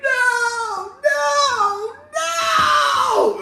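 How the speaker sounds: very high, loud wailing no; hammed-up desperate cry; mock anguished wail. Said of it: "It was like".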